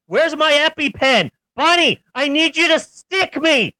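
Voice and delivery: high, frantic allergic panic; panicked loud plea. Acted. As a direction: beg for the EpiPen in alarm. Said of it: "I added the loud "stick me" part".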